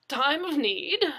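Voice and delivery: a touch of bumbling confusion. Acted.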